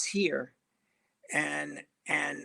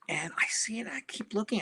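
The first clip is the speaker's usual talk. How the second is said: hushed whisper; 5 semitones higher, 6 dB quieter than usual. Retold or imitated. Spotting Richard Pryor in lobby.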